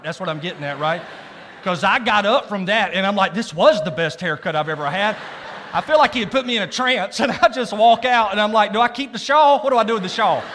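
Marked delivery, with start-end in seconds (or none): 1.6-10.4 loud, high, carried-away storytelling; excited climax. Retold or imitated. Preacher raving to the crowd about his haircut, loud and excited. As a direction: build to a loud, high-pitched pitch of amazement.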